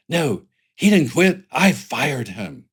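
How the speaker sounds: loud, slow, high playground comeback; mock childish retort. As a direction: imitate a kid insisting in a playground argument. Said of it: "what is this childlike attitude".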